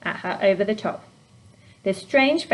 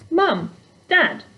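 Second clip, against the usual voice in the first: calling out; 9 semitones higher, 4 dB louder than usual. A narrator's reading. Calling sleeping parents.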